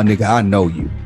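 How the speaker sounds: normal talking voice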